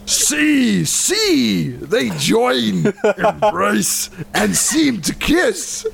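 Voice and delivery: high, slow, hammed-up Scottish recitation; drawn-out Scottish ogre verse; badly faked Scottish. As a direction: declaim Shakespeare in a mock Scottish accent. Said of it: "these are gonna sound exactly the same".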